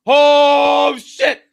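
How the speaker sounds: very high, loud, breaking squeal; high shocked squeal; shriek. Real, not acted. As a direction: squeal like it hit you. Shrieking reaction.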